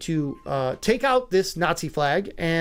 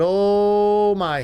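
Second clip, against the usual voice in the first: drawn-out oh my; 3 semitones higher, 5 dB louder than usual. Real, not acted. Host mocking the lost narrative.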